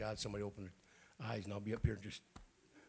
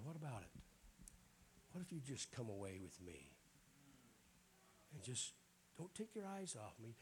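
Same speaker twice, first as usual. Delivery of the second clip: much softer, gentle and intimate; tender Jesus voice; 10 dB quieter than usual. Acted. Preacher voicing Jesus inviting a believer, softly and tenderly. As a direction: speak it close and gentle, like an invitation.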